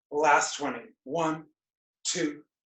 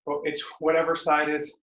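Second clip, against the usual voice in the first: ordinary talk, nothing marked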